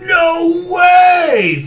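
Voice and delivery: high furious yell; storybook buffalo shouting no; huffy shout. A narrator's reading. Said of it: "Fluffalo huffled".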